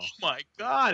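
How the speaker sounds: high, dismayed exclamation; acted dismay. Host learns what he put on for the kids, acted high dismay. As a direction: replay being mortified. Acts out his horrified reaction.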